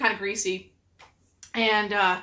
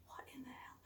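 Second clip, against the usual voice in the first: whispered disbelief; a hushed what-the-hell; baffled whisper; 32 dB quieter than usual. Retold or imitated. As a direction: whisper it like you can't believe your eyes. Wife baffled watching husband.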